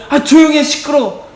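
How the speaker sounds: high, loud irritated shushing; crowd hushing him. Acted. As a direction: cut him off like an annoyed crowd. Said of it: "사람들이 막고". (시끄럽다고 버럭 말리듯이)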